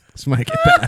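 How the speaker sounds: high laughing warning. Real, not acted. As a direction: laugh through the line.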